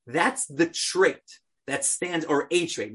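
ordinary talk, nothing marked

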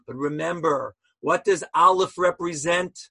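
ordinary talk, nothing marked

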